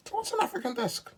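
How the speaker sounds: very high-pitched bafflement; high, baffled query; incredulous question. Real, not acted. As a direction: squeak the question out in disbelief. Repeats the question in disbelief.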